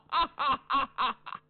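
long ringing high laugh; bitter laugh. A narrator's reading. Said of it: "哈哈一笑".

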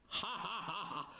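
rhythmic "ha-ha-ha-ha", performed; scornful stage laugh. Acted. Cheng Yaojin laughing at his challenger, theatrical laugh. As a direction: laugh it out in even bursts.

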